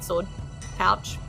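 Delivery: ordinary talk, nothing marked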